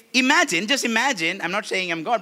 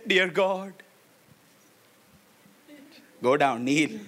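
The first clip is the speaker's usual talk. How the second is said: soft pleading prayer; gently voiced kneeling prayer; mock prayer; 2 semitones lower, 6 dB quieter than usual. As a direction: pray as a humble man. Acted prayer, repeated by [name].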